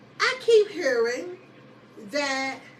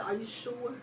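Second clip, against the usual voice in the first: softly voiced, retold question; quiet checking; 3 semitones lower, 10 dB quieter than usual. Sergeant checking with her soldier, quiet careful question. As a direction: ask it low and soft, as if confidentially.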